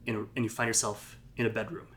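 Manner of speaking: ordinary delivery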